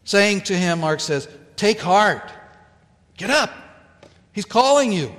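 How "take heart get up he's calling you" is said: higher, brighter urging to get up; encouraging crowd. Acted. Crowd telling Bartimaeus Jesus calls him, eager encouragement. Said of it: "turns from discouragers to encouragers".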